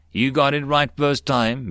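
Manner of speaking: plain reading voice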